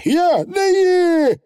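very high indignant Feegle; high squeaky denial; Feegle bravado. Acted. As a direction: laugh off the very idea. Feegle denies fear.

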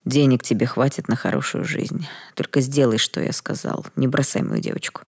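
ordinary delivery, nothing marked

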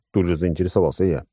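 usual speaking voice

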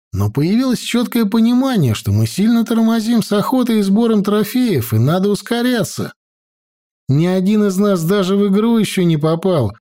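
usual speaking voice

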